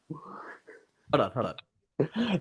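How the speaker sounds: a hint of a cartoon character stalling; cartoonish voice. Co-host pausing the clip, faintly cartoonish. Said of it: "Love that cartoonish voice".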